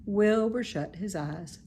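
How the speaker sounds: usual speaking voice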